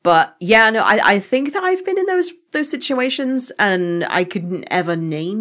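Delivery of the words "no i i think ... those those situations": ordinary delivery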